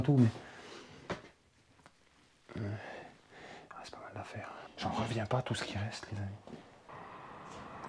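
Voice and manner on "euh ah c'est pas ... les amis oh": whispering. Real, not acted. Hushed look round abandoned house.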